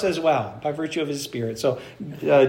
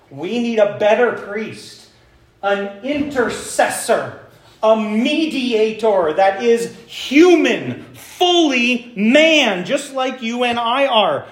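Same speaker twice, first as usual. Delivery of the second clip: slow, louder, rising preaching push; building preacher emphasis; 5 semitones higher, 4 dB louder, slower than usual. Acted. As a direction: drive the point home to the congregation.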